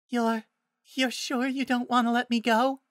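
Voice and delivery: very high, timid little voice; meek, hesitant and high-pitched; nervous. Acted. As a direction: stammer a nervous plea in a high voice. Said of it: "she asked nervously".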